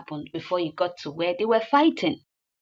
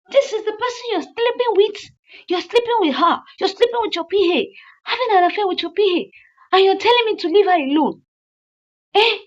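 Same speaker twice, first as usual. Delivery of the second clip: shrieking higher and louder, words racing; furious wife; 10 semitones higher, 4 dB louder than usual. Retold or imitated. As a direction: explode at the cheating husband. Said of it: "And she was like".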